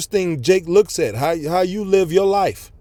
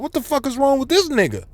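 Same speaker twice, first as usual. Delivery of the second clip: raised, incredulous rant at a stubborn son; high, fed-up disbelief; exasperated parent; 7 semitones higher than usual. Retold or imitated. Parent fed up with son touching stove, high exasperated rant. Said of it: "You gonna be like".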